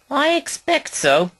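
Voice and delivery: a high, sulky mutter of a line. A narrator's reading. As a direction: sound put out and grudging. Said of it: "sulked Mavis".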